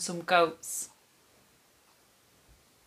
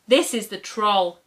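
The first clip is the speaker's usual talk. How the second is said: plain reading voice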